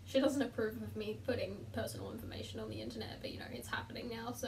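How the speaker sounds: near-whispered confession, very soft; hushed aside. Real, not acted. Vlogger admits mum disapproves of oversharing online, hushed aside. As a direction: murmur it under your breath.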